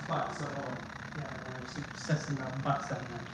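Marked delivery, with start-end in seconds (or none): 0.1-0.8 faint, crackly spirit box voice; ghost voice box. Retold or imitated. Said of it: "like the ghost voice box".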